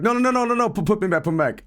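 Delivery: high, rapid-fire mock begging; panicked pleading. Dreamer just woken, begging to go back, mock panic.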